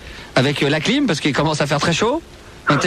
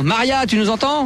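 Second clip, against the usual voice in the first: higher, drawn-out call down a bad line; raised pitch, stretched questioning call; 5 semitones higher, slower than usual. Real, not acted. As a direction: call slowly to someone who can't hear. Calling to caller who cannot hear.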